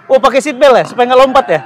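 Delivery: loud, thrilled chatter; buzzing with excitement before the ride; excited. Real, not acted. Strapping into the VR ride.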